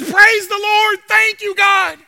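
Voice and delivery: high, loud thankful praise; joyful shout. Retold or imitated. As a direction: rejoice out loud. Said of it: "groceries on the porch".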